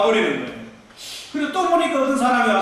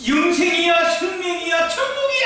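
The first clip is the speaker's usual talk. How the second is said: higher, rising to a high ecstatic shout; ecstatic cry; 6 semitones higher than usual. Retold or imitated. Preacher reliving paradise, crying out in ecstasy. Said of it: "소리를 쳤습니다".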